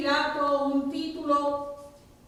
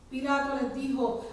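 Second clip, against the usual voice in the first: usual speaking voice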